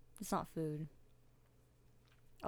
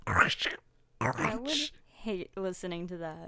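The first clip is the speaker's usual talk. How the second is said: whispering; much slower than usual. Real, not acted. Whispered, slow reaction.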